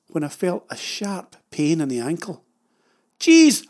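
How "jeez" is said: much higher, loud pained yelp. Retold or imitated. Stung by a wasp on the ladder, loud yelp. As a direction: yelp at a sudden sting. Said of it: "I yelped".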